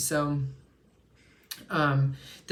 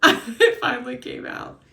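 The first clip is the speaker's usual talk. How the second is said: laughing; 8 semitones higher, 8 dB louder than usual. Real, not acted. Relieved after mispronouncing a word.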